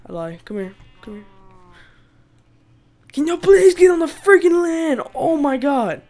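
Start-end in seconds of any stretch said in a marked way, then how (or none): 3.1-6.0 loud, high pleading shout at the animals; exasperated begging shout; frustrated yelling. Real, not acted. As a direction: yell at the cows to get on land.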